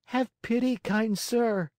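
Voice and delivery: very high, pitiful puppet voice; high-pitched tearful plea; pitiful pleading. A narrator's reading. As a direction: beg like a weeping little puppet. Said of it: "asked in a pitiful voice".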